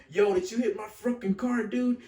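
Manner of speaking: loud, high re-enacted confrontation; acted-out yell, defensive and accusing; defensive outburst. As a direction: shout it like you're confronting the other driver. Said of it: "getting all defensive".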